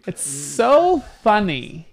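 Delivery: put-on slow drawn-out Valley Girl "It's so funny"; Valley Girl accent. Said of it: "I have to say it in a Valley Girl accent".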